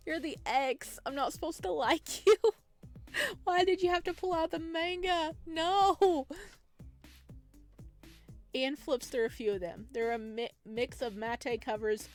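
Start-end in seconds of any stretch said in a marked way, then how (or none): none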